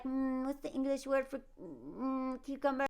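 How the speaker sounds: soft, hesitant mock of a woman groping for an English word; mocking her English. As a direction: play her as if English were foreign to her, quietly. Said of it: "when she was like".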